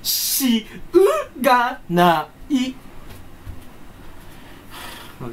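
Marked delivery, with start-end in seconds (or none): 0.0-2.8 "しゅ" then a slow, crying "うがない"; whiny stressed しゅうがない. Pretend. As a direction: whine "しゅうがない" out slowly. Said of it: "強めに発音してる人". (泣きそうにゆっくり「しゅうがない」)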